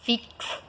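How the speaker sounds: "fixo" broken as FIK-S-SO; slow "fixo" with a separate hissed S; isolated KS demonstration. Shown as a demonstration. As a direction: stress the KS inside "fixo" by splitting it. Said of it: "mas você fala".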